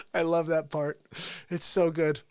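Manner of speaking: normal talking voice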